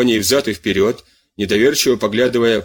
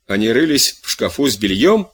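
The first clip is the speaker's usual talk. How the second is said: plain reading voice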